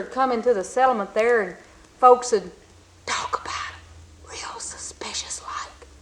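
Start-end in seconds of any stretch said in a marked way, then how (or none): 3.0-5.9 whispered gossip, hushed; suspicious whisper. Acted. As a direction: whisper it like folks talking behind a back. Gossip voiced behind backs.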